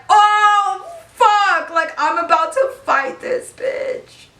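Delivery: high, loud wail easing into slow dread; hammed-up panicked squeal, then drag it out; mock panic. Teller replaying the moment she must get off and fight, panicked. Retold dread on being ordered off.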